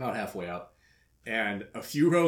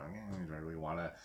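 low, soft, half-hearted grumble; reluctant sulk, trailing under the breath; 6 semitones lower, 13 dB quieter than usual. Retold or imitated. Fan talking himself out of going, sulking. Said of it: "I was kinda sulking a little bit".

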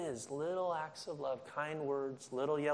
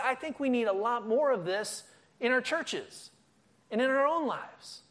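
high, loud fervent preaching; rally the congregation with rising zeal; fired-up sermon climax; preaching at full force; 10 semitones higher, 5 dB louder than usual. Real, not acted.

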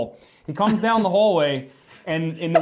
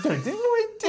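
mock disbelief. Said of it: "I'm like".

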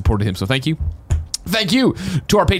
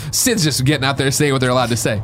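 ordinary talk, nothing marked

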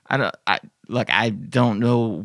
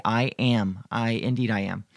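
ordinary talk

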